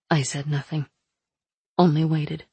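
ordinary delivery, nothing marked

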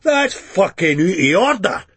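a touch of ferocious Begbie; ferocious snap. Acted. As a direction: snap at the woman in outrage. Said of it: "Begbie snapped ferociously".

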